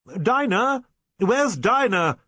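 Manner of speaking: high, loud call, rising on the name; alarmed cry. A narrator's reading. Rector bursts in looking for Dinah, crying out. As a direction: cry out for her, voice pitched right up. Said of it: "cried the rector".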